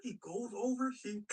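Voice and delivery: ordinary talk, nothing marked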